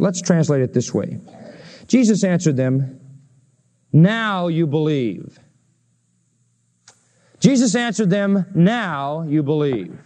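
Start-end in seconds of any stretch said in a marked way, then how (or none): 3.9-5.4 "Now" hit hard and drawn out, then falling away; slow line leaning heavily on "Now"; stress on NOW. Shown as a demonstration. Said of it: "Emphasizing the word now". 8.5-10.0 "Now" stretched long, the rest stepping down; stress on NOW. Shown as a demonstration. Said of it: "Emphasizing the word now".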